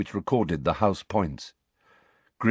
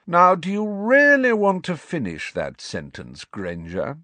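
lazy arrogant nobleman; 9 semitones higher than usual. A narrator's reading. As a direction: taunt her, lazy and superior. Malfoy interrupts with menace.

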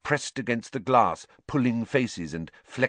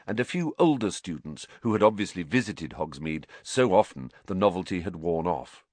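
plain reading voice

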